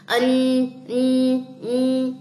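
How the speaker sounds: "ان" held with a humming nun; nasal nun sound. Shown as a demonstration. Teacher shows students the hidden nun sound, humming nasal. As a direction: hum the "ان" clearly, three times. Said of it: "آواز آپ کو آئے گی نون کی".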